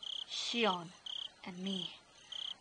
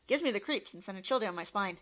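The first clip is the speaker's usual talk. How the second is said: plain reading voice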